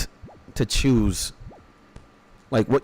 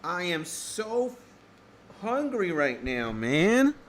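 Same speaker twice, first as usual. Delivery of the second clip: whiny hungry moan; 7 semitones higher, 7 dB quieter, slower than usual. Real, not acted. Streamer starving before breakfast.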